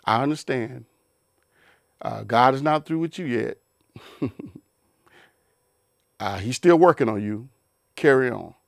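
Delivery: low, gentle and forgiving. Retold or imitated. The spouse answering a third time, calm and accepting. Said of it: "would your response be".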